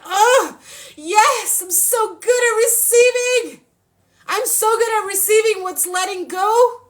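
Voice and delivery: high, rising ecstatic cry of I'm so good at receiving; blissful high-pitched rapture. Acted. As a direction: let the joy burst out way up high. Ecstatic affirmation climax.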